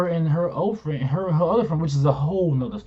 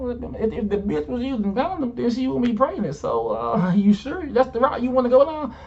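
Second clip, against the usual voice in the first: mocking retort; 9 semitones higher than usual.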